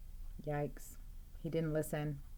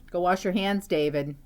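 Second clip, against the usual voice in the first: scolding mom; 6 dB louder than usual. Acted. Mom's line read aloud.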